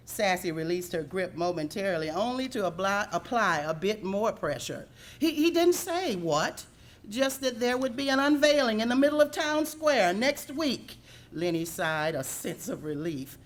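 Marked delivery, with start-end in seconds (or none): 5.2-11.0 high, loud frantic stammering; pinned goose babbling in panic; nervous stammer. Acted. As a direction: stammer it out under her paw.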